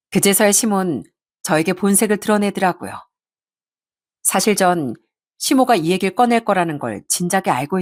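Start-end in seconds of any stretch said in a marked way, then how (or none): none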